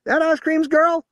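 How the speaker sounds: very high, loud twangy shout. A narrator's reading. Bearded lady shouting to Sam, wild. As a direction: shout it out wildly. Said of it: "as she shouted".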